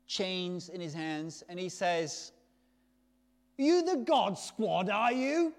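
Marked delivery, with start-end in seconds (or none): 3.6-5.5 loud, high, drawn-out mock Yorkshire challenge; attempted Yorkshire accent. Gang member confronting street evangelists, loud attempted Yorkshire accent. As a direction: put on a rough try at Yorkshire, much louder and higher. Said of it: "With a Yorkshire accent that I can't do".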